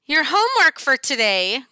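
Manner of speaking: high, loud grinning monster voice; a grinning monster teacher hands out homework. A narrator's reading. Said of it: "grins Missus Green".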